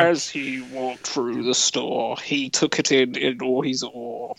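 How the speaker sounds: slow, badly faked film narrator; ponderous amateur narrator impression; Morgan Freeman. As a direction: have a poor go at a drawn-out voiceover. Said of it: "Wow, that was bad".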